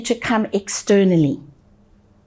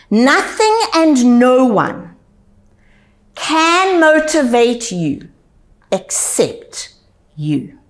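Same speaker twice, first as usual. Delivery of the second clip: slow, deliberate repetition; slower than usual. Shown as a demonstration. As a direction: stretch each phrase slowly, pausing between them. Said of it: "I will say it twice".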